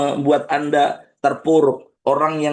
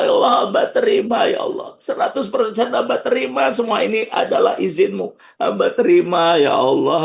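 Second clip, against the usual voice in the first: emotional crying supplication to God; tearful prayer; 2 semitones higher than usual. Shown as a demonstration. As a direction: pray it as if close to tears. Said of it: "omong aja nangis".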